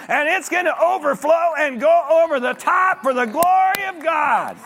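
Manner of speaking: high, loud, rising preacher's cry; building fund sermon, preached high and loud; preaching at full force. Acted. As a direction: lift the church up to the glory.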